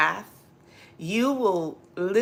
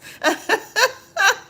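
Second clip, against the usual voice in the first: laughing; 7 dB louder than usual. Real, not acted.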